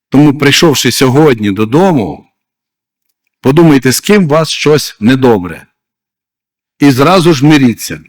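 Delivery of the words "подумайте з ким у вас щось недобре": usual speaking voice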